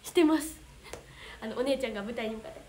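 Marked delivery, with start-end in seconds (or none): none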